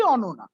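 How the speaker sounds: "ओनोना" with rounded o vowels; Bengali-accented "ओनोना"; Bengali pronunciation. Shown as a demonstration. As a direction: say "ओनोना" as a Bengali says Ananya. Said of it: "जो accent है".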